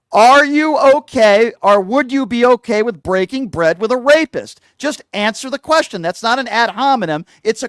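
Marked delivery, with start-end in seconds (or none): 0.1-2.8 slow loud demand. Real, not acted. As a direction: drag out each word, louder. Host presses guest, heated.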